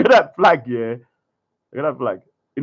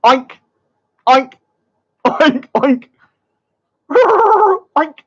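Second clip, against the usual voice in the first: snorting oink oink like a pig; 5 semitones higher than usual. Pretend. Mocking him with pig noises, hammed up.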